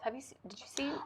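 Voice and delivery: soft conspiratorial whisper. Real, not acted. Co-host asking the host about her elf in a whisper. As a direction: lean in and whisper the question.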